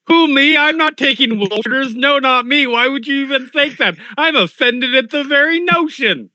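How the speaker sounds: high, indignant denial; mock offended voice. Titling the roll for listeners, mock offended. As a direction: protest your innocence, high and put-upon. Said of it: "I read it in your voice".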